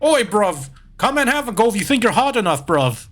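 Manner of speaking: fast, high mock British taunt; bad British accent. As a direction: goad them like a hammed-up British lad. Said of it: "I think I heard Grant Kirkhope say that".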